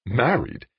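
high, quick yelp of disbelief; startled yelp. A narrator's reading. Dan learns Jane is married, startled yelp. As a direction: yelp it, pitch jumping up then falling. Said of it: "he heard himself yelp".

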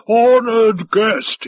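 loud, high, very slow address; grand host. A narrator's reading. Five Tide presenting the flask to his guest, grandly. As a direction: proclaim it slowly to the guest.

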